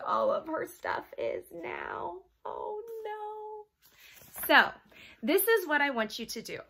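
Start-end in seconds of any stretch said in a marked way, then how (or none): none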